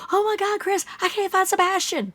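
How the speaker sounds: high distressed parent voice; mock frantic plea. A parent telling a friend the boy vanished, frantic. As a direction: plead as if your child is gone. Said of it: "I'd be like".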